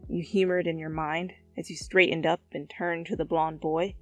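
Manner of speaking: ordinary delivery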